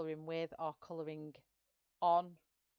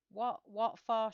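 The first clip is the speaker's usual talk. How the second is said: puzzled; 5 semitones higher, 6 dB louder, slower than usual. Real, not acted. Puzzled by viewer's question.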